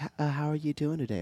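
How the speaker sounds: put-on high, soft, quick frail old voice; lightly imitating a frail old lady; weak old lady voice. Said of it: "like a old, weak lady's voice".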